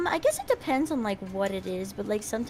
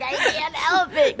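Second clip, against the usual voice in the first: mock whine; 5 semitones higher, 6 dB louder, slower than usual. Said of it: "It's like".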